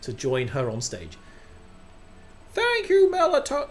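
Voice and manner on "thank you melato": very high, loud put-on voice; council head's proclamation. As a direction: announce it grandly in a high voice. Resumes council head's put-on speech voice.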